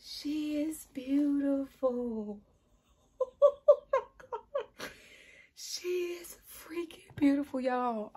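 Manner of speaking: high, loud, slow gushing squeal with a laugh; overjoyed high-pitched gushing; excited squeal. Real, not acted. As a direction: squeal with delight at a newborn. First sight of the doll.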